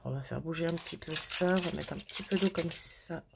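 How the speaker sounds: ordinary talk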